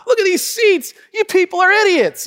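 high, smug voice; smug family. Retold or imitated. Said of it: "they're like".

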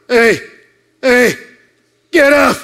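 gruff wake-up call. Retold or imitated. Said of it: "a voice going".

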